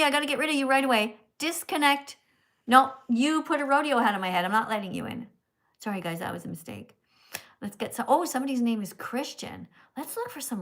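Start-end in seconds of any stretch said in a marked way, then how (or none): none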